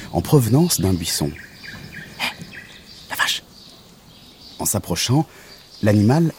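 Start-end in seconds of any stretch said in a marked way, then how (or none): 2.2-3.4 tiny whispered insect call; toute petite voix. Acted. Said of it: "une toute petite voix". (toute petite voix soufflée)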